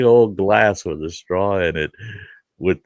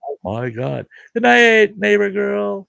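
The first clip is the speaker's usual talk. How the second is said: much higher, louder delighted squeal; amazed; 14 semitones higher, 4 dB louder than usual. Retold or imitated. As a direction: gush in ecstasy over the flavor. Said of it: "And I'm just like".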